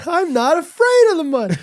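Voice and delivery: high falsetto denial; mock whiny protest. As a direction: squeak it back in comic indignation. Echoes the mocking in protest.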